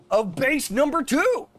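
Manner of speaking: high, slow, drawn-out silly voice. Pretend. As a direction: stretch it up into a goofy high pitch. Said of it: "I shouldn't do that with my voice".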